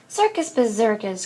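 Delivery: mildly exasperated groan; understated weary protest. A narrator's reading. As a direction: dismiss it with a mother's groan. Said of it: "groaned Mama".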